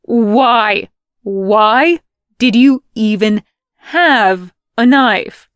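loud and high, heated; loud bickering. Acted. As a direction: shout back in the spat. Heated banter argument.

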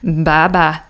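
drawled Southern bye-bye; Southern accent. Shown as a demonstration. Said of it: "No, more Southern".

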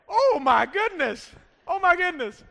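high, loud mock amazement; astonished reaction. Astonished onlookers' reaction acted out.

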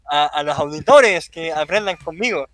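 loud, high, through laughter; laughing defensively. Real, not acted. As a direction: protest while cracking up. Defending himself while laughing.

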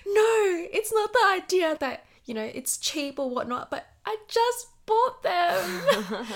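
imitating a high, whiny friend near tears; upset friend. Upset friend over lost earring.

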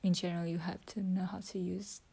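soft whispered talk to her phone; whispering. Real, not acted. Whispering her English practice into the phone. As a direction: keep it to a whisper throughout.

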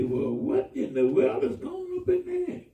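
high, slow puzzled question; puzzled coworker. Retold or imitated. Coworker outside the bathroom asks, high and slow. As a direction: ask it like a baffled coworker outside the door.

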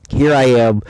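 normal talking voice

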